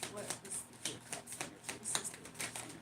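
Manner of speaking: soft unvoiced whisper. Real, not acted. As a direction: whisper the question to yourself. Whispers question to Spirit.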